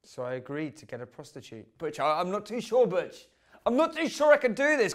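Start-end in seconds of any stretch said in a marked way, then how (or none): 1.8-4.9 rising, high-pitched hesitation; hammed-up reluctant whine; nervous protest. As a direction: plead to be let off, voice climbing. Acted protest to Butch.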